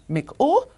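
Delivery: Miku with a sharp catch after the i, high and clipped; stop in miku. Shown as a demonstration. Teacher marking the stop in Miku for learners. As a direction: clip Miku with a stop in the middle. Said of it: "There's a stop right here".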